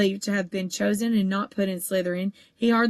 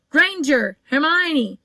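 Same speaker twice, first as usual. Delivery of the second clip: calling out; 6 semitones higher, 6 dB louder, much slower than usual. A narrator's reading. Teacher calling out names.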